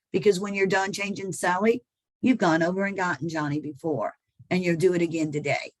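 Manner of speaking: normal talking voice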